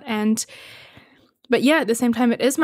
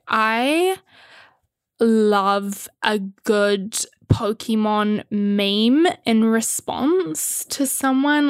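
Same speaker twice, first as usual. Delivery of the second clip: 1 dragged out; much slower than usual. Real, not acted. Savouring admission.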